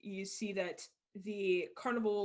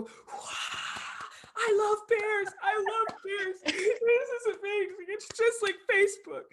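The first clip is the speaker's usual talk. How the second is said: crowd cheer; 10 semitones higher, 4 dB louder than usual. Acted. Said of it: "crowd go".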